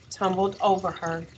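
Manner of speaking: usual speaking voice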